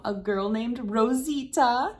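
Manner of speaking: usual speaking voice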